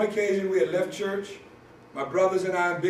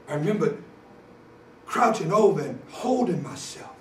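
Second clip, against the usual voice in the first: normal talking voice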